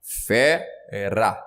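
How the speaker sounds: "Fera" split slowly into Fe, ra; sílabas separadas. Shown as a demonstration. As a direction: break "Fera" apart, syllable by syllable. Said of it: "se eu separar". ("Fera" separada em sílabas, fe... ra)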